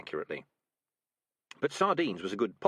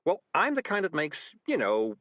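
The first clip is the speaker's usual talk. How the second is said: a touch of desperate fluster; lightly flustered cat making excuses; cat covering up, mildly desperate; 4 semitones higher, 4 dB louder, slower than usual. Acted. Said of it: "said Morris desperately".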